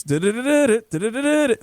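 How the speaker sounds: quick high woodpecker rattle; imitating a woodpecker drumming; rat-a-tat woodpecker. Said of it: "He just made that noise".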